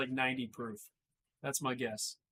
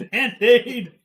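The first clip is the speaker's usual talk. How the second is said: laughing; 7 semitones higher, 11 dB louder than usual. Real, not acted. Echoes tasting note laughing.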